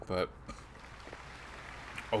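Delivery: usual speaking voice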